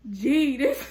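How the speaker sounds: loud, high mock exclamation; joking outburst about the man; mock exclaim. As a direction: blurt it out loud to the friend. Texting best friend, mocking him.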